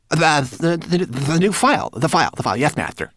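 high, loud, stammering servant; flustered cry. A narrator's reading. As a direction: gabble it in eager panic. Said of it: "he cried".

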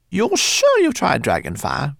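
put-on high squeaky rat girl; perky little rat voice. Lola the rat asks.

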